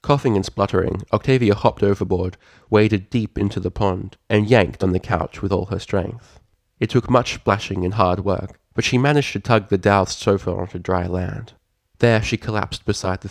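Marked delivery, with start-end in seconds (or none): none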